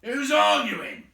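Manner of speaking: high, loud indignant troll; troll retort. A narrator's reading. William snapping back at Bert, loud and high. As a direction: fling the question back hard. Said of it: "said William".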